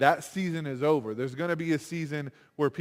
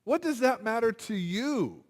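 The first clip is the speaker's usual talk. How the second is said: mildly irritated Jesus, pitched higher; a touch of annoyed rebuke, raised pitch; a little angry; 6 semitones higher than usual. Retold or imitated. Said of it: "little angry and goes".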